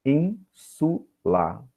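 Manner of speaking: "Insula" very slowly, split as in, su, la; syllable by syllable. Shown as a demonstration. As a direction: break "Insula" into three separate syllables, pausing between each. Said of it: "et tres syllabae". ("Insula" sílaba por sílaba, bem devagar)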